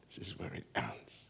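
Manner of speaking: whispered, low and soft; whispered ending. Acted. Preacher ending the repeated phrase in a whisper. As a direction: let it fade to a whisper.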